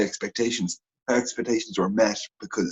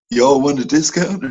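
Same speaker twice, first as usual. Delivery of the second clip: slow, louder, mock sales-pitch drawl; Joey; 4 semitones higher, 5 dB louder than usual. Joking that he'd walk in offering a deal, Joey impression. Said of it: "would your man Joey, uh, just come in and just be like".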